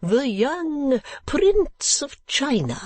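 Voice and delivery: very high, slow, swooping inquiry; much interest. Acted. Old gentleman asking man below about a prince, much interest. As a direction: eccentric old man, keenly curious. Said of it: "with much interest".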